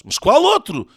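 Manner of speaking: high, loud insistent question. A narrator's reading. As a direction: press for the name. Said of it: "insiste Sócrates".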